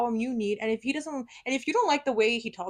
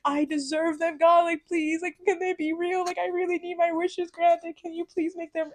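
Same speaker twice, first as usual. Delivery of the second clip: put-on higher, half-hearted pleading as a little kid; retold begging; 9 semitones higher than usual. Retelling her childhood prayer for fairy godparents, mild pleading. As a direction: beg God like a child, lightly. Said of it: "I was, like, begging".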